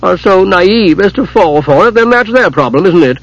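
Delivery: high, wheedling blame-shifting; whining. A narrator's reading. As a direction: whine that it's someone else's fault. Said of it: "Hidjukel whined".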